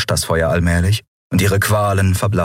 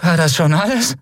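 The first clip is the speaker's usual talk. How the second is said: high-pitched, strained taunt; gasping defiance; 9 semitones higher than usual. A narrator's reading. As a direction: defy the torturer while out of breath. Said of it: "keuchte sie". (keuchend trotzig, mit hoher Stimme)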